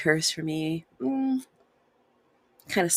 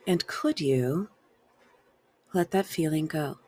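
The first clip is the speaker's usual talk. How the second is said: guided release questions. Acted.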